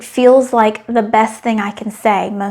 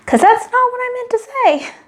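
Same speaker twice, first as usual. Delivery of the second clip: on the edge of tears; 13 semitones higher than usual. Retold or imitated. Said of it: "now shaking and on the edge of tears".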